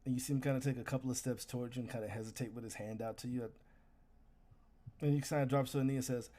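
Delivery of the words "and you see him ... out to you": ordinary talk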